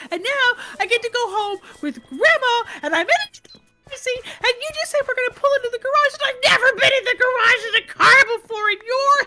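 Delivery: put-on high, fast child's excited rush; excited little girl. Granddaughter telling grandma about her day, high excited child voice.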